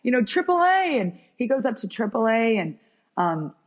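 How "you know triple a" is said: high, thin happy-cry voice, lightly imitated; retold tearful joy. Telling him about the triple A call-up, mock tearful. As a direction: pitch way up as if overcome with joy. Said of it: "I'm crying".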